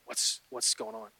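very soft, low, slow retold query; small, acted nervous murmur; hushed anxious question. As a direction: ask timidly under your breath. Brother anxiously waits for news.

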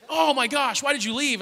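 high, exasperated mock outburst; furious. Little brother blaming older brother for leaving, imitated angry exasperation. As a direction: accuse him like a betrayed kid brother. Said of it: "furious and angry".

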